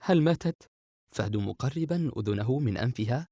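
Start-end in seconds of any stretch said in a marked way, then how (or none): 0.0-0.7 worried. Acted. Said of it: "بندر بقلق".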